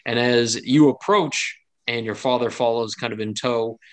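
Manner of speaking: normal talking voice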